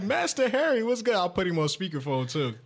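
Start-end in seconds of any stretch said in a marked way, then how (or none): 0.0-1.1 high excited greeting; hype greeting. Retold or imitated. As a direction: call out his name with glee. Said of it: "I'm like".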